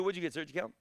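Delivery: ordinary talk, nothing marked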